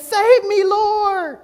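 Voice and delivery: very high, loud mock cry for help; hammed-up squealing panic; scared cry. Sinking Peter begs Jesus for rescue, comically scared. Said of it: "he kinda gets scared".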